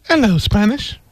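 high, put-on posh English accent; a Princess Diana-style greeting. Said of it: "sounds like Princess Diana".

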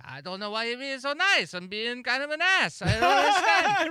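put-on high, bewildered accent, cracking into a laugh; high-pitched Italian voice going from puzzled to loud; confused Italian accent. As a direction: play the baffled man, laughing at the end.

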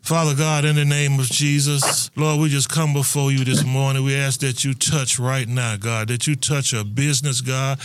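low, fervent prayer voice. Acted. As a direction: pray earnestly over her, low. Leads a prayer.

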